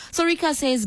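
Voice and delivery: ordinary talk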